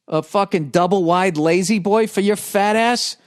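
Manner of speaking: sickly sweet politeness hiding an insult; fake-polite. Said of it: "a nice way to say it".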